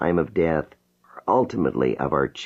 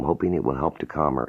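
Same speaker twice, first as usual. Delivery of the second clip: ordinary delivery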